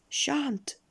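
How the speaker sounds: very high, cheeky refusal; grinning goblin. A narrator's reading. Goblin refusing Mister Uppity, high and grinning. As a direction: refuse in a squeak with a grin. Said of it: "grinned the goblin".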